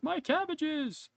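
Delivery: high, drawn-out wail; yelled in pitch but not in volume; mock yell. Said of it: "then I yelled".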